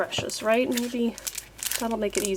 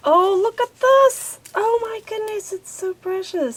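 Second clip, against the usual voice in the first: much higher and louder, thrilled gasp of delight; delighted squeal; 11 semitones higher, 5 dB louder than usual. Real, not acted. Crafter gushing over a tiny bookmark charm, high delighted squeal. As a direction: gush over it like a cute puppy.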